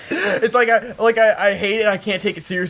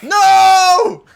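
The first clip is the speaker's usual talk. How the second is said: sudden loud high "No!"; shout; 13 semitones higher, 10 dB louder than usual. Real, not acted. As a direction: blurt it out in disbelief. Outraged interruption at the nickname rule.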